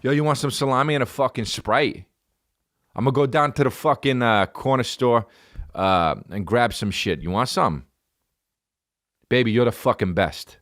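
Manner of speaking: hammed-up Jersey guy offering snacks; thick New Jersey sweet talk; Jersey accent. Lover offering salami after sex, comic Jersey accent. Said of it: "I have a fucking serious Jersey accent".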